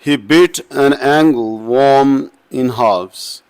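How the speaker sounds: teacher slowly scanning an example line; iambic recitation. Shown as a demonstration. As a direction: read it out like a scansion demo for the class. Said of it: "जैसे".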